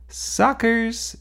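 high, teasing shout of "Suckers"; shouted taunt. A narrator's reading. Sophie taunting the brothers stuck folding laundry, high shout. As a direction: crow it at the boys from the hallway. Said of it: "shouted Sophie".

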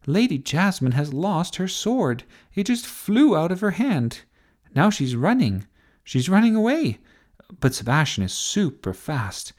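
high-pitched, animated play-by-play; excited commentary. A narrator's reading. Sophie calling the chase for TV, excited commentary. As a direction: follow the chase with mounting urgency. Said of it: "shouted Sophie".

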